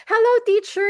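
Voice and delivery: put-on high, sweet little-girl greeting; child's voice. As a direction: chirp it like a cheerful child. Girl greeting teacher now.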